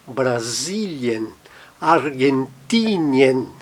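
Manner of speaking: Brasilien, Argentinien slowly, ending "i-en"; unstressed IE as two vowels. Shown as a demonstration. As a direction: stretch the stressed syllable, then "i-en", in Brasilien and Argentinien. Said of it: "a pronúncia fica I, E".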